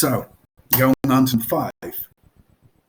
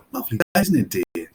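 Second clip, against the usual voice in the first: ironic; 2 semitones higher than usual. Retold or imitated. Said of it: "and you say".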